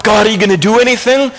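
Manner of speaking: high, hurried, agitated question; worked up. Acted. Worked-up believers question God, agitated. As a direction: demand an answer, pitched high and pent up. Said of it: "we're so worked up".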